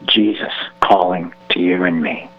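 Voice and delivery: usual speaking voice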